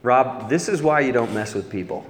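low, soft imitation of a dismayed friend; gone quiet. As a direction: drop the voice, a subdued reproach. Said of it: "he just gets quiet".

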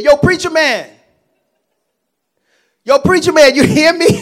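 high, loud shout across the room; calling out. Retold or imitated. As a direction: call him from far away, hammed up. Inmates calling him across.

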